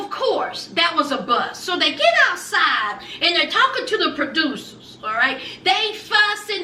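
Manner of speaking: ordinary delivery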